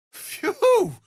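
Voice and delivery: loud, high puff at the heat; high whistled phew. Acted. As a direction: blow out a sharp, high "phew". Reacts to Karlach's burning heat.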